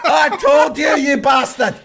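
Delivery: high, shouted I-told-you-so; hammed-up yelling in triumph and outrage; furious vindication. Retold furious vindication on the phone.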